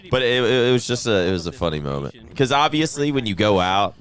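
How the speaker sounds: normal talking voice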